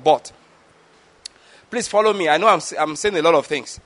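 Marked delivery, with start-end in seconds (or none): 0.0-0.3 but set apart as a single word; stress on but. Shown as a demonstration. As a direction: drop the word but in firmly, lower. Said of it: "emphasizing that word".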